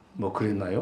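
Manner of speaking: soft, coy Seoul speech; coy like a hostess to a guest; Seoul accent. Shown as a demonstration. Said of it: "뭐 이렇게 하는 거".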